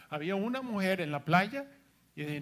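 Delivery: normal talking voice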